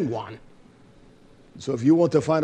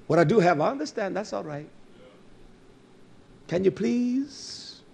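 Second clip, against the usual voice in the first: ordinary talk, nothing marked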